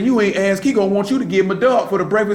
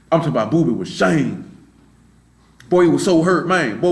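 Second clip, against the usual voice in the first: ordinary talk, nothing marked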